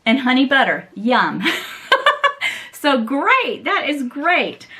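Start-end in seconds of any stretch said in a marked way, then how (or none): 1.5-2.6 high bubbly giggle; delighted laugh. Real, not acted. Opening a friend's gift box, laughs with delight. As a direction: burst into a happy high laugh.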